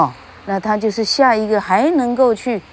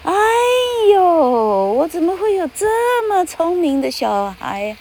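much higher, hammed-up gushing delight like an actor; exaggerated praise; 11 semitones higher than usual. Shown as a demonstration. Teacher showing parents how to praise a child, hammed-up gushing. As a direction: overact amazement at a clever child. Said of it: "像演员…那夸张到他".

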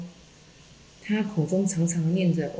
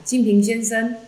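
mild narrated call, pitched up; 5 semitones higher than usual. A narrator's reading. Zen master persistently calling the layman, mild shout. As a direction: raise the pitch to hail him, without full volume. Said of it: "仙崖禅师能又喊".

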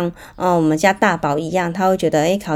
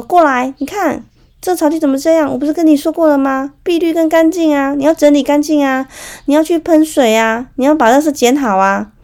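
high, loud exasperated scolding of the son; imagined angry scolding; 8 semitones higher, 4 dB louder than usual. Retold or imitated. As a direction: pour out the bottled-up frustration, raised and fast. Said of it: "他真的很想说". (拔高音量、气急败坏地数落孩子)

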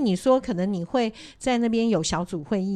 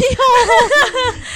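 high, loud real laugh; burst of laughter; 11 dB louder than usual. Real, not acted. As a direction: laugh out loud at a silly question. Amused by question about electric door.

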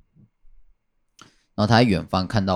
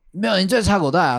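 imitating a stubborn boss accusing a coworker; 11 semitones higher than usual. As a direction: retell the boss insisting with a light hammed-up edge. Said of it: "很凶".

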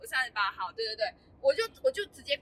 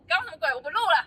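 ordinary talk, nothing marked

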